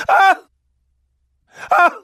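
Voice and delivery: sharp high yell from the back room; crazy uncle holler. Retold or imitated. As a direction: let out a wild scream. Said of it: "you'll hear him hollering".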